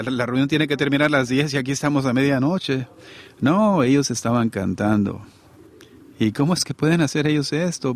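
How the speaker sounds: ordinary delivery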